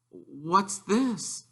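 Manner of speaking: put-on high, soft questioning boy voice; Harry asking. Harry asking his aunt, high soft wary question. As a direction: ask it small and wary. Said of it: "he asked Aunt Petunia".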